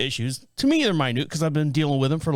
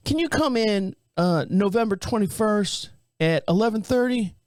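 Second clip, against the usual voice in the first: imitated receptionist; 5 semitones higher than usual. Receptionist finding a patient an earlier slot, imitated higher voice. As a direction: play a woman checking the schedule. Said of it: "I get a girl on the phone".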